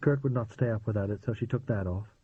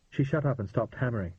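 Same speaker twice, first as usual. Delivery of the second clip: usual speaking voice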